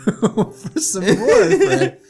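character line broken by laughter; laughing through the line. Acted. Game player reading the examination line aloud, laughing. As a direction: read it cracking up.

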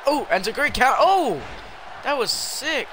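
real thrilled exclamation; excited outburst. Real, not acted. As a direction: react big to a great move. Reacts to a move in game.

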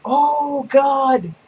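ordinary talk

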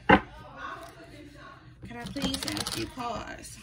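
slow whisper, asking softly; whispered request. Real, not acted. A reader whispering to her deck while shuffling, slow whisper. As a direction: breathe the ask to the cards, slowly.

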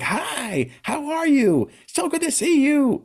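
mock over-cheerful squeal of a greeting; super high-pitched happy voice. Friend greeting a bereaved parent, in exaggerated super-high happy voice. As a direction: imitate a friend's grating, very high, happy hello. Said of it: "a super high pitched, like, really happy voice".

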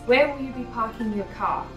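usual speaking voice

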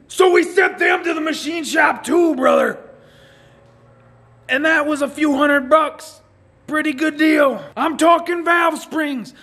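high, loud, slowed hype; hyped shouting. Acted. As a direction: preach the parts list like a revival. Hype rant about parts.

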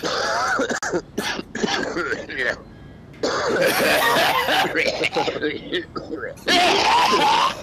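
loud rasping hacking cough; smoker's cough. Pretend. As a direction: hack it out like a chain smoker. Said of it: "do the best smoker's cough".

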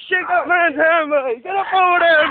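usual speaking voice

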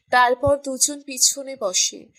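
ordinary delivery

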